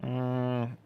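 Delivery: usual speaking voice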